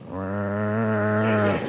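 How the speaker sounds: a newborn calf's feeble bleat; low, soft imitated calf noise; calf's first cry. Revived calf makes a sound.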